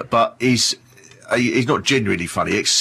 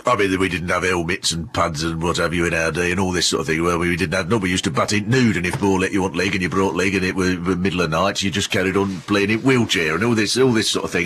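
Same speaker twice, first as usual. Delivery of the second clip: a lightly done Yorkshire moan, lower and grumbling; moany Yorkshire voice; 4 semitones lower than usual. Retold or imitated. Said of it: "he's got this moany Yorkshire voice".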